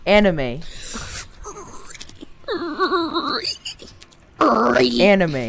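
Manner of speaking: goofy shout. Real, not acted.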